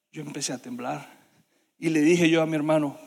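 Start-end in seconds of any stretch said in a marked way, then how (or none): none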